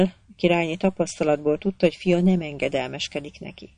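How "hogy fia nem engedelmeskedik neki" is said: ordinary delivery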